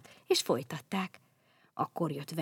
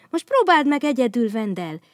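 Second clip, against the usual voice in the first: "próbáld meg egyedül" higher and louder, cheering; eager agreement; 4 semitones higher, 8 dB louder than usual. Acted. Bogyó backing Babóca, loud cheering "próbáld meg egyedül". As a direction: urge him on brightly. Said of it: "helyeselt Bogyó".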